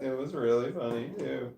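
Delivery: ordinary talk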